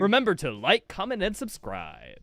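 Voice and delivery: hammed-up YouTuber voice. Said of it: "Yeah, the YouTuber voice".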